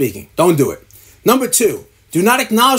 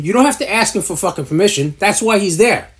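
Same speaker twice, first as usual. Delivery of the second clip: ordinary delivery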